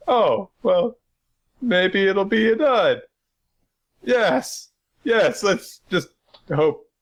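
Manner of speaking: high, strained put-on character voice; hopeful mock voice. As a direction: play them as squeaky wishful thinkers. Acting out worried characters in recap.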